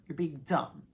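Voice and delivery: low, matter-of-fact telling-off; low scolding. Real, not acted. Telling a contestant off, low scolding. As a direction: say it low, as a verdict. Scolding her, first take.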